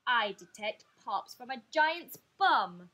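loud, slow declaration; roaring. Acted. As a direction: announce it slowly and loudly. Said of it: "roared the mean stalk".

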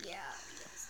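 soft whispered yes; whispering. Real, not acted. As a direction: whisper it under breath. Whispered agreement.

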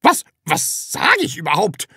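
startled, halting question; stammering. A narrator's reading. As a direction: stumble over the first word, baffled. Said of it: "stammelte Frau Rotkohl".

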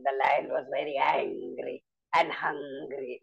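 slow, low growling lion voice; a storyteller's low, slow angry lion; angry hungry lion. Shown as a demonstration. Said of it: "I did it with the voice modulations".

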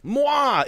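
high smacking air kiss; blown kiss. Acted. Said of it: "first kiss".

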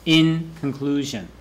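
"In" held and stressed, then a falling "conclusion"; weight on "In", ease off after it. Shown as a demonstration. Teacher shows students the emphasis, stressing "In". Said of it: "you emphasize the in".

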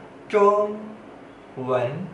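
中文 very slowly, held high 中, long pause, rising 文; slow tone drill. Shown as a demonstration. Teacher asking learners to try slowly, 中文 drawn out tone by tone. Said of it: "Coba ngomong pelan-pelan".

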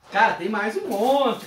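ordinary delivery

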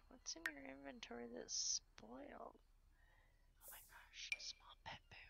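hushed amazed whisper. Real, not acted. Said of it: "I don't know why I'm whispering".

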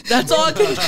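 high, loud, cracking into laughter; breaking up laughing. Real, not acted. Host giving up on the sketch, high laughing squeal. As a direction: squeal it as the giggles take over.

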